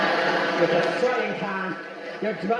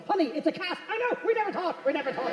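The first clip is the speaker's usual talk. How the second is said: much higher, hammed-up astonished squeal; mock astonishment; 9 semitones higher, 3 dB quieter than usual. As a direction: play the dim couple's fake discovery. Mocking couple's fake realisation.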